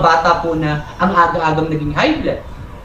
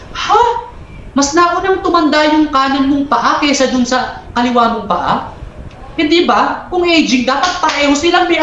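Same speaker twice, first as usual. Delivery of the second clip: higher, incredulous, with a laugh; mock disbelief; 7 semitones higher than usual. Doctor teasing the patient about one aging knee, mock disbelief. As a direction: act amazed at the silly answer.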